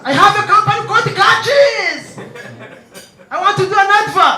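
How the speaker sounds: hammed-up foreign client; mock client voice. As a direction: spell it out like a visiting big shot.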